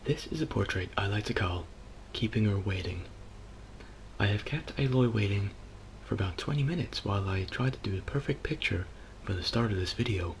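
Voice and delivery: hushed, gentle painting-show narration; soft painter voice. Acted. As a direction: murmur it like a calm TV art teacher. Bob Ross-style soft painting narration.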